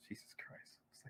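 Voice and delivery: a breathless whisper under the breath; whispered aside. Real, not acted. Host stuck on the maths whispers to himself. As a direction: mutter it to yourself, barely voiced.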